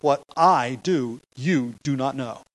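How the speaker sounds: slow down and lean on "I", then on "you"; emphatic contrast. Acted. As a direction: stress on "I" and "you", each set apart. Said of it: "a very emphatic distinction between the I and the you".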